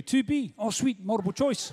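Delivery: mock Kiwi accent reciting Shakespeare. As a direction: play the Bard as a chirpy Kiwi. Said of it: "Why can't there be a Kiwi Shakespeare?".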